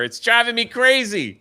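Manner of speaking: high, loud comic exasperation; venting loudly, half in fun; exasperated outburst. Real, not acted. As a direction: cry out as if at wit's end. Venting about the election, laughing around it.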